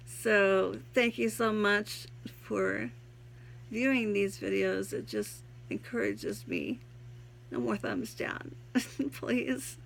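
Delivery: ordinary talk, nothing marked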